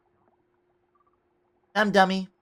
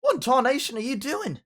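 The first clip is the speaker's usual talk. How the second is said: a cartoon cowgirl hollering in a country accent; country Applejack accent; 8 semitones higher than usual. Shown as a demonstration. Gamer slips into Applejack's country accent. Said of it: "Gotta do my Applejack".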